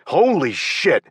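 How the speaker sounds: loud startled shout; yell. A narrator's reading. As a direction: yell as a lamp flies at you. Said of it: "I yelled".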